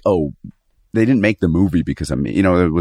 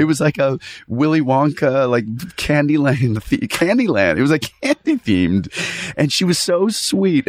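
gushing; 7 semitones higher than usual. Retold or imitated. Gushing about candy party.

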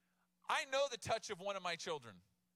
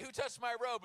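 higher, louder, casually demanding question; Jesus asking with attitude; 6 semitones higher, 4 dB louder than usual. Retold or imitated. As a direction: toss it out like a bro asking the crowd. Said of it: "he's like".

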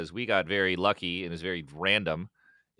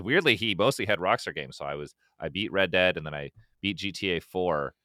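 normal talking voice

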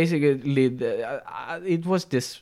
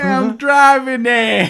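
high, louder, slow put-on voice; a silly hurried driver; mock driver; 11 semitones higher, 8 dB louder, slower than usual. Imagined driver explains his rush, high slow put-on voice.

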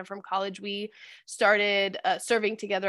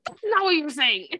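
high, emphatic contradiction; acted-out exasperated friend; mock exasperation; 7 semitones higher, 4 dB louder than usual. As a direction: contradict in comic frustration.

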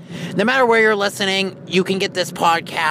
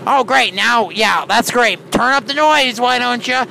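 sarcastic; 6 semitones higher than usual. Acted. Annoyed at pilots' noise.

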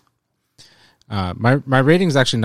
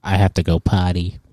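childish put-on voice, a bit louder; little kid voice; 4 semitones lower, 3 dB louder than usual. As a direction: whine like a small child needing the toilet. Playing small child in class.

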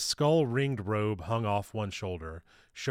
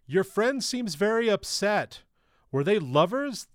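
speaking loudly; 11 semitones higher, 6 dB louder than usual. A narrator's reading. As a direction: raise your voice to be heard over wailing. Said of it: "Rhyme had to speak loudly to be heard over the din".

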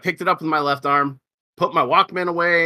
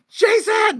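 calling out. Retold or imitated. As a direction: yell across the shop for Jason. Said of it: "And you're like".

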